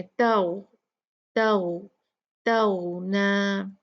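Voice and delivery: तौना stretched, the end held two counts; two-count stop. Shown as a demonstration. Teacher showing the two-count pause at the verse end. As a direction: draw out तौना slowly before stopping. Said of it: "दो count करके रुकेंगे".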